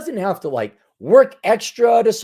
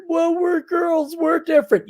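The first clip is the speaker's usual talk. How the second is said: mocking girls' excuse; 9 semitones higher than usual. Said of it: "don't you girls dare".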